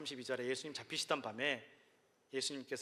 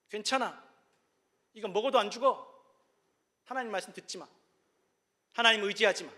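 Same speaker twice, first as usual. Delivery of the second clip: higher, louder coaxing devil voice; tempting devil; 5 semitones higher, 7 dB louder than usual. Acted. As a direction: lure them in like the serpent, rising as it goes. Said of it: "마귀가 속삭였습니다".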